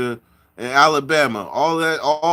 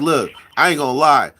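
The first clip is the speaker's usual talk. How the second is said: ordinary talk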